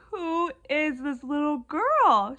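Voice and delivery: slow, loud, high exclamation; amazed. Retold or imitated. Retelling her reaction to a surprise hug, amazed and loud.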